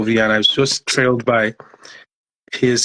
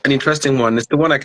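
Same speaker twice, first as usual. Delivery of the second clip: ordinary talk, nothing marked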